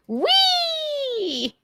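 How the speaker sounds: very high, drawn-out whee; playful squeal. Pretend. Host breaking off mid-reassurance, long high squeal.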